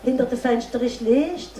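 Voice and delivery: unhurried storyteller's voice with long pauses, painting the moonlit scene; fairy tale narration. A narrator's reading. Storyteller telling a festival audience a fairy tale, dreamy narration. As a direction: draw the audience into the night, line by line.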